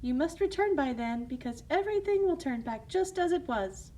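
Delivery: high, sweet motherly voice; kind fairy godmother. Acted. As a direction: reassure her gently.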